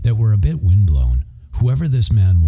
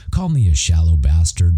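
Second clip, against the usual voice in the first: usual speaking voice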